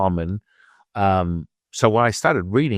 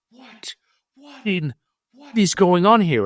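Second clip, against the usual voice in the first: stunned disbelief; 11 semitones higher, 3 dB louder than usual. Retold or imitated. Teacher reeling after his secret is read, stunned disbelief. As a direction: pitch shoots way up in sheer disbelief. Stunned reaction to a psychic reading.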